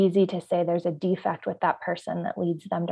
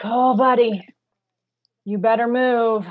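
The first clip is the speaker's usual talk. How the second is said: a strained, patient warning to the stalled driver; forced calm warning; 3 semitones higher, 7 dB louder than usual. Pretend. Driver at a green light, warning the car ahead, strained calm. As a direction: hold the anger down, warn him. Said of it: "you take a deep breath and you go like".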